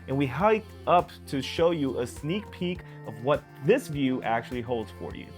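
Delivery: ordinary talk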